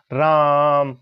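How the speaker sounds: राम with the long आ stretched out slowly; drawn-out vowel. Shown as a demonstration. Teacher stretching the vowel in राम to show the long-sound mark. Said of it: "इतना लंबा खींच रहा हूं बीच में".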